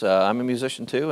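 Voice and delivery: ordinary delivery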